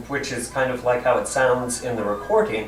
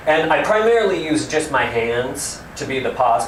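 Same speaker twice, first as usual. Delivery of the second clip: normal talking voice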